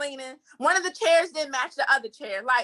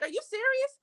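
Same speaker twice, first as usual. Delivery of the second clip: disbelief; 11 semitones higher, 4 dB quieter than usual. Retold or imitated. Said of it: "you was just like".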